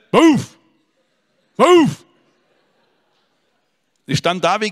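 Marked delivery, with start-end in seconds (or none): 0.1-2.0 loud, high imitated barking "Wuff! Wuff!"; dog bark. Imitating the dog that jumped at him in town, loud high bark. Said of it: "Der hat so gebellt".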